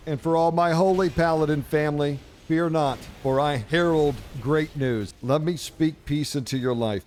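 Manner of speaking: mock preacher. Mock sermon to paladin family.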